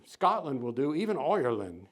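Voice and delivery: lightly put-on Irish lilt; passable Irish brogue. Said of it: "he says in a passable brogue".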